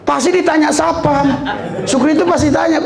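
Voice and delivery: usual speaking voice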